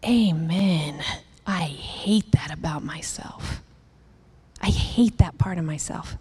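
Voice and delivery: low, soft, half-whispered self-reproach; whispered regret, slow; hushed confession. Retold or imitated. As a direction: confess it quietly to yourself. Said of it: "to go".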